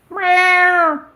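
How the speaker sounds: high meowing to call the cat. Shown as a demonstration. Owner calling her cat Ba with high meows. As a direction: meow up high like calling your cat home. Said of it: "That's how I call her".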